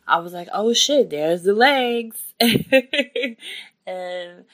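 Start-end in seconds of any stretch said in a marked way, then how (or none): 0.5-2.2 loud, high amazed outburst; thrilled disbelief, blurted out; excited exclamation. Retold or imitated. Retold moment baby comes out. 2.4-3.6 high, loud burst of laughter; bright laugh. Real, not acted. Laughing after the retold moment.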